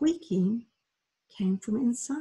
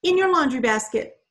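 urgent; 5 semitones higher, 6 dB louder than usual. A narrator's reading. Mandy finishing urgently.

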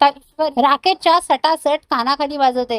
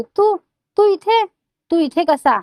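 higher-pitched, halting cry of alarm; lightly acted startled outcry, pitched up; mild frightened yell; 5 semitones higher, slower than usual. Shalaka on suddenly seeing Rakesh, a mild alarmed yell. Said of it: "जोरात ओरडते".

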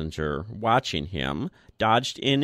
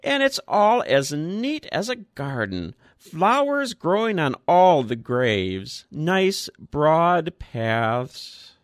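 put-on higher, lingering old voice; fond old man; 6 semitones higher, 3 dB louder than usual. A narrator's reading. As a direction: savour the broad paths like a treat. Old Woodfield continues.

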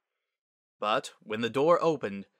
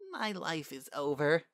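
put-on higher, woeful young voice; despairing; 5 semitones higher than usual. Filly alone in the principal's office, despairing. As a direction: lament your own doom. Sunset despairs awaiting parents.

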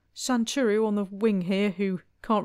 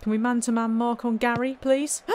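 ordinary delivery

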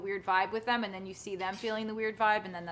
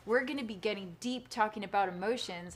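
ordinary talk

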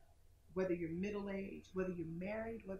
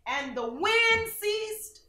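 slow, high, loud proclamation; dramatic rise; 12 semitones higher, 9 dB louder, much slower than usual. Acted. Preacher dramatizing the calmed storm, rising loud. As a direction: proclaim it with awe.

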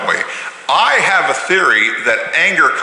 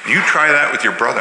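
ordinary delivery

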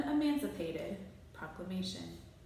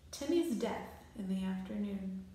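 plain reading voice

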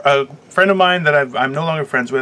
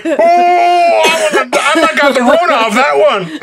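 stoned friend impression; 8 semitones higher, 3 dB louder than usual. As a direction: imitate an over-served bong smoker, wailing high. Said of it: "just looked at me and was like".

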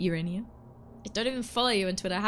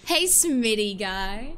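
drawn-out greeting; 5 dB louder, much slower than usual. Real, not acted. Stretched greeting to chatter.

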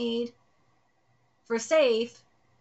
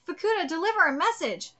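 normal talking voice

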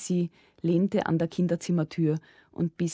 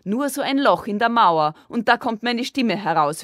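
loud, higher, insistent explanation; announcing; 6 semitones higher, 8 dB louder than usual. A narrator's reading. Sissi explains her secret telephone, loud proud announcing. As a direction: proclaim it with raised voice. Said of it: "verkündete Sissi".

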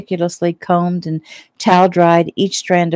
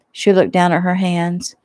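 usual speaking voice

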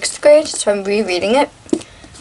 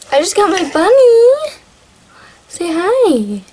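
excited; 10 semitones higher, 3 dB louder, slower than usual. Real, not acted. Presenting bunny, high voice.

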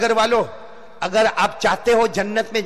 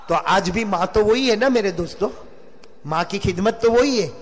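normal talking voice

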